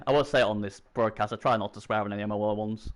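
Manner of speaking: normal talking voice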